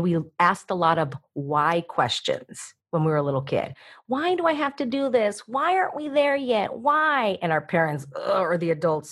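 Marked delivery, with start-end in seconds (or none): 4.1-7.4 put-on high whining child voice; whiny kid. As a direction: whine like a nagging little kid. Kid whining why questions.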